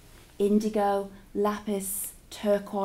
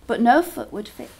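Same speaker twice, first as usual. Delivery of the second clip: usual speaking voice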